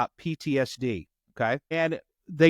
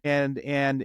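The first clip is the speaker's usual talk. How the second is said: ordinary talk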